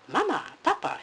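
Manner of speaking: high, loud little cub calling; small boy bear calling his parents; tiny little bear voice. Acted. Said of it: "he called in his tiny little voice".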